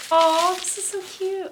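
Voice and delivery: high, slow, cooing over something cute; gushing. Real, not acted. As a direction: coo it like it's adorable.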